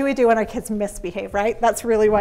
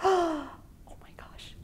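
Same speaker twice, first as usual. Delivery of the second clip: whispered gasp, high and slow; shocked gasp; 7 semitones higher, 9 dB quieter, much slower than usual. Retold or imitated. Gasp at cereal spraying.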